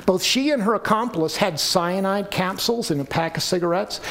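usual speaking voice

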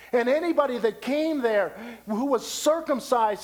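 high, driving sermon delivery; forceful preaching. Real, not acted. Preacher explains circumcision to the congregation, preaching at full force.

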